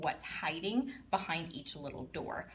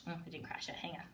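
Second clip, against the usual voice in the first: ordinary talk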